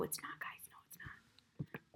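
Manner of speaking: whispered denial; whispering. Real, not acted. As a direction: breathe the denial.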